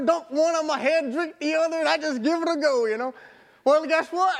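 ordinary talk

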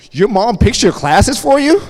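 high, loud imitation of baffled classmates; mock incredulous question. Classmates question a student about his mom, mock incredulous. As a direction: act out loud, high-pitched disbelief.

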